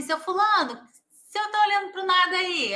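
lightly voiced caregiver checking on someone; lightly acted caregiver. Caregiver to an old man staring at nothing, lightly acted questioning.

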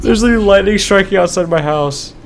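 nervous laugh into high-pitched alarm; really scared. Real, not acted. Gamer telling viewers about the lightning outside, scared. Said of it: "I'm so scared right now".

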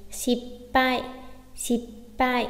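"Shippai" with a held double p; "Shippai" in two separated beats; geminate stop. Shown as a demonstration. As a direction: close the lips after shi, pause, release pai in "Shippai". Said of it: "chúng ta có gấp đôi chữ".

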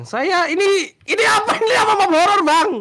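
high, rising panicked cry; alarmed protest. Real, not acted. Friend finds out it's a horror map, alarmed high protest. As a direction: wail it out in mounting alarm.

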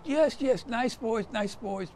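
high, nervous soothing voice; placating German. Retold or imitated. German placating under officer's warning.

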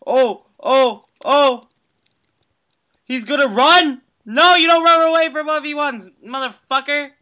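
high, loud furious yelling; outraged yelling. Real, not acted. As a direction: shout him down for running. Opponent runs from duel.

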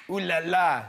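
lightly put-on French "Ooh la la"; a hint of French flirting; mock French accent.

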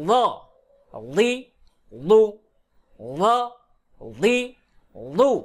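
"ল, লি, লু" as heavy emphatic Arabic dad; thick dad. Shown as a demonstration. Teacher demonstrates dad from tongue root, thick sound. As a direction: thicken "ল, লি, লু" from the back side of the tongue. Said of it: "জিহ্বার গুড়া হইতে মোটা আওয়াজে".

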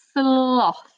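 ordinary delivery

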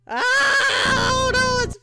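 high-pitched scream into wailing; hammed-up fright. Gamer scared by Applejack, hammed-up high wail. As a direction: shriek and whimper in exaggerated fear.

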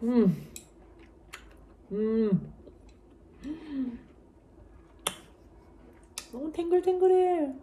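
humming while chewing food; closed lips, muffled chewing; mouth full, chewing. Real, not acted. Seen 0: Oyster put in mouth at -1, cheeks full, chewing throughout.

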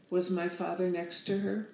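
ordinary delivery

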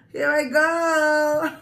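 slow, high, loud build-up; psyching up for the challenge; excited. Real, not acted. About to start eating challenge.

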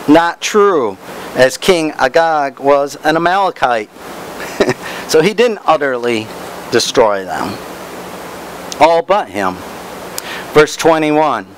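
usual speaking voice

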